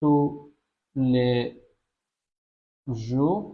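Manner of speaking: tous les jours, each word spaced out and slow; slow syllable-by-syllable. Shown as a demonstration. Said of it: "প্রতিদিনের ফরাসি হবে হচ্ছে tous les jours".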